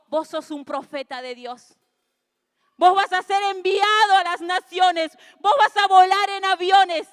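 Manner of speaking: profecía proclamada cada vez más fuerte; proclamación. Retold or imitated. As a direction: declara con fuerza sobre el hijo. Madre profetiza sobre su hijo. (la madre le profetiza al hijo, en voz alta)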